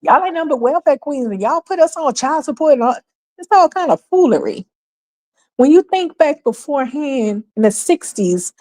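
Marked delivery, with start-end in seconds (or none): 0.0-4.7 higher pitched mock scolding voice; mocking imitation. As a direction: parrot them back in a high, snide voice. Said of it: "Welfare queen calling us welfare queens".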